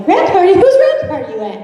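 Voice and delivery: high, fast, indignant; mock jealous outrage. Sarah at home, jealous of another host's party, high indignant. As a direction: play up her mentor's huff. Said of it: "she's, like, getting pissed".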